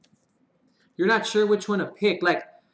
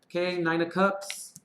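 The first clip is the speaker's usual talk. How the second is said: usual speaking voice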